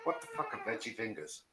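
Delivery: quiet fast laugh through the words. Real, not acted. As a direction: snicker while asking. Laughs at veggie fingers.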